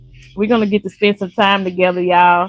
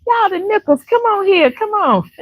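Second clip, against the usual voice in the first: high, eager hostess voice; warm welcome call; 13 semitones higher than usual. Retold or imitated. Imitating the neighbor welcoming the Nichols, high. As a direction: beckon the guests in, all delight. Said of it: "they just welcomed me right".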